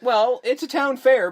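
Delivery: high, loud and slow; emphatic. Real, not acted. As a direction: insist it loudly. Arguing the point.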